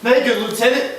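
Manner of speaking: loud, high pleading call; urgent shout. Acted. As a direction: shout to hold him back. Montano restrains Cassio.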